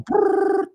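high shivery brrr; shiver brrr. Shown as a demonstration. Host showing his pre-show jitters, shivery brrr. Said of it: "as you can tell".

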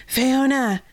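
high, loud anguished call; cry out. Acted. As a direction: call her name in despair. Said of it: "Yvain cried".

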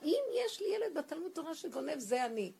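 loud, high, impassioned imitation; retold shouting to God. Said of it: "אז הוא התחיל לצעוק להשם".

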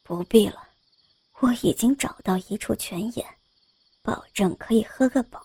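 low, hushed, teasing male voice; teasing young scholar. A narrator's reading. Young scholar teasing the maid, low hushed tease. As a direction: breathe it low and sly. Zhang Sheng voiced again.

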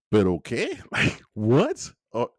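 incredulous laugh into a rising what; baffled laugh at a nonsensical plot twist; laughing disbelief. Real, not acted.

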